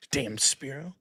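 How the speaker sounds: ordinary talk, nothing marked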